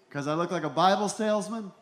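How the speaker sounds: ordinary talk, nothing marked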